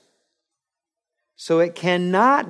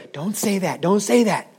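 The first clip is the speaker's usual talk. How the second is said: mock warning; 6 semitones higher than usual. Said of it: "So they'll tell you".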